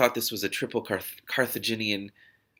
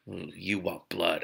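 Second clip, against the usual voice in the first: resigned; 4 semitones lower, 7 dB quieter, much slower than usual. A narrator's reading. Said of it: "I said resignedly".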